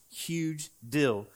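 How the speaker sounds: ordinary talk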